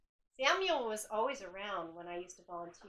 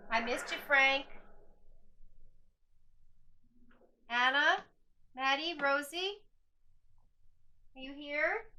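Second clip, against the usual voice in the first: higher, louder call into the room; 5 semitones higher, 6 dB louder than usual. Real, not acted. Ghost hunter calling out to the hotel spirits, loud and hopeful. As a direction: call out to the spirits, drawing them near.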